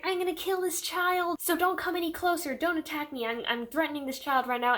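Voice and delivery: high, mock-menacing villain voice; villainous hostage threat. Recapping a book, she voices Tatiana threatening the child: high hammed-up villain. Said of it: "She's like".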